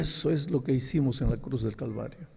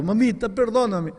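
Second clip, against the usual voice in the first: crying plea; 8 semitones higher, 4 dB louder than usual. Retold or imitated.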